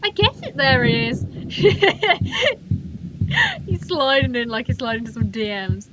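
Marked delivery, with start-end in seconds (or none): 1.3-3.6 high giggling burst; laughter. Real, not acted. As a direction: crack up in high-pitched giggles. Player laughs at the creature.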